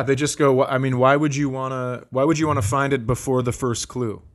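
ordinary talk

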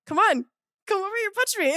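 high-pitched taunting challenge; daring. Retold or imitated. Student standing up to classmate, taunting. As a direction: goad him into it, re-enacted. Retold dare to fight.

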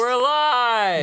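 high, loud announcement; excited. Real, not acted. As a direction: call it out with a burst of energy. Announcing the stream start.

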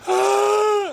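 high, loud mock wail; imitated crying wail. Husband mimics his wife crying on the phone, high mock wail. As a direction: burst out in an exaggerated sob-scream. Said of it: "Leanne's fucking crying aggressively".

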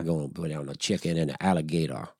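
put-on Louisiana Cajun TV cook accent; Cajun chef. Imitating a TV chef, folksy Cajun accent. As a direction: talk like a Cajun cooking host. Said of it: "he was the Cajun chef".

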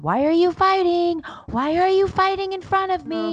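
high, wailing kid imitation; distressed child. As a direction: plead like an upset little boy. Voices upset son.

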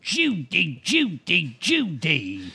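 hammed-up star imitation, each name swooping down; Cary Grant. Interviewer imitating a much-copied catchphrase, hammed-up impression. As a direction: repeat the name in separate, falling swoops. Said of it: "Cary Grant would always go".

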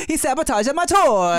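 put-on high, laughing accent; Italian-sounding Japanese accent. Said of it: "my Japanese accent came off kind of Italian".

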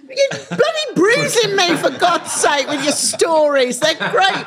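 high, laughing protest through giggles; laughing mock complaint. To chatty Richard, a high laughing protest. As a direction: complain while cracking up.

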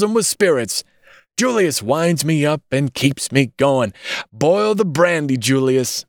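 put-on high-pitched, effusive drunken ranting; staggering drunkard, exuberant. A narrator's reading. Drunkard praises his associate to a visitor, ranting high. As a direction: play a wild drunk hailing his friends.

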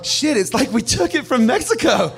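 laughing through the punchline. Real, not acted. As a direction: break into laughter mid-line.

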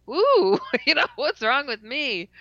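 high, loud startled cry breaking into a laugh; a laughing ouch of surprise; startled yelp. Retold or imitated. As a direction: yelp in shock, then laugh at yourself. Hit the floor hard.

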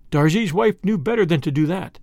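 plain reading voice

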